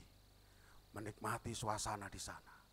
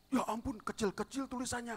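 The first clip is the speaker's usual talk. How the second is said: surprised; 13 semitones higher, 5 dB louder than usual. Retold or imitated. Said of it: "kata dia".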